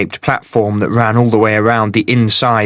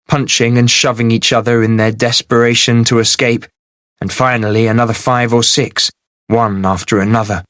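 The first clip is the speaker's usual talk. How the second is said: ordinary delivery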